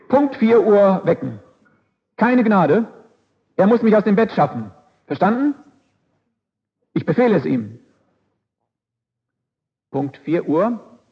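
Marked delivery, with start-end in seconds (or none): 0.1-7.8 king barking a firm wake-up order; royal command. Acted. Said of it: "befahl er seinem Kammerdiener". (befehlender König)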